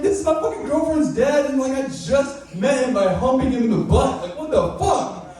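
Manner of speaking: high-pitched freaked-out rant, pitch jumped way up; panicked high voice. Retold or imitated. Stand-up comic reenacting panic after meeting girlfriend's dad, high and frantic. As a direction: squeal it like you just realized who he is. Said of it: "I was like".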